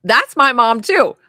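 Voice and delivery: ordinary talk, nothing marked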